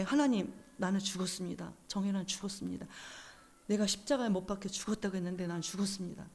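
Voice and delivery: soft, subdued retold prayer; hushed, surrendered declaration; confession in prayer. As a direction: confess it quietly to God. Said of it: "그렇게 고백하니까".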